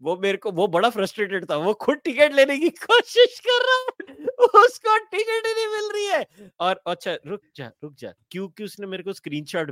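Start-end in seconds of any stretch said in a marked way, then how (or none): 1.6-6.2 laughing. Real, not acted. Amused retelling of friend's frustration.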